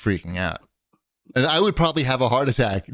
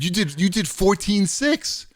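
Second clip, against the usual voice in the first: mock gym guy; 9 semitones higher, slower than usual. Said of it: "He's like".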